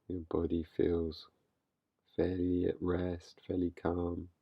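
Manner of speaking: calm, unhurried guiding voice with long pauses; guided meditation. Real, not acted. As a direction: gently lead listeners into stillness, leaving space between phrases. Leads a meditation.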